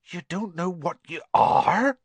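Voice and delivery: high, rising to a loud finish; bewildered. A narrator's reading. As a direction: build to an incredulous question. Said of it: "with a bewildered stare".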